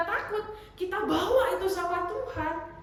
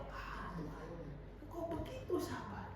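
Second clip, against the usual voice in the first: very soft murmured prayer; hushed awe; 3 semitones lower, 12 dB quieter than usual. Retold or imitated. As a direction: murmur to God in quiet wonder. Acts out own awed prayer. (doa lirih penuh kagum)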